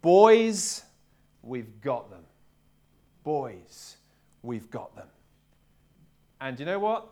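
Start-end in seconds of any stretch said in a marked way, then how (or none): 0.0-2.3 put-on loud cry of Boys, then drops right down on we've got them; captain rallying his huddle, loud then low, no Welsh accent; rallying call to the team. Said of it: "but he said". 3.2-5.1 softer and lower, almost to himself; captain's hushed second telling in the huddle, no Welsh accent; quiet confident repeat. Retold or imitated. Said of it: "but he said".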